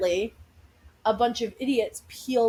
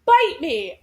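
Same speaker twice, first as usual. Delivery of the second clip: retold retort; 6 semitones higher, 8 dB louder than usual. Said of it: "I go".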